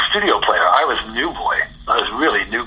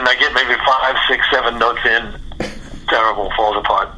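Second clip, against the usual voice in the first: ordinary talk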